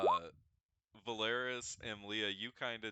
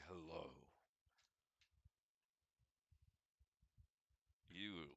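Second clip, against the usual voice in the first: deep voice; 5 semitones lower, 6 dB quieter than usual. Acted. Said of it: "you hear a deep voice".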